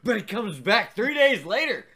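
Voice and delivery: excited punchline. Real, not acted. Punchline joke, lively.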